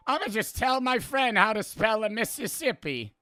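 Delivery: put-on Italian accent. Italian man's punchline.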